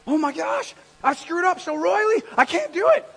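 high, loud hammed-up wail; mock a man wallowing in guilt; despairing self-reproach. Preacher imagining Paul stuck in guilt, mock despair. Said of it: "He could have just stayed there like".

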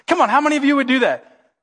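ordinary talk, nothing marked

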